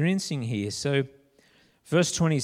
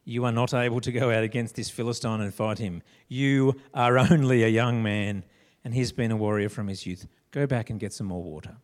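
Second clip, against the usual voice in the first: lower, fatherly Saul; 8 semitones lower than usual. Acted. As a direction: talk him down like a weary king. Saul dismissing David, read low.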